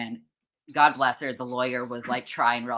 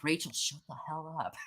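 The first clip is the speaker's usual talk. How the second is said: hushed rebuke; 3 semitones higher, 12 dB quieter than usual. Retold or imitated. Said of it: "the lawyer's like".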